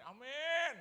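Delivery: high, loud drawn-out amen; big rising shout of amen; crowd's amen. Retold or imitated. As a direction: belt out one amen like a whole congregation. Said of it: "Semuanya gini".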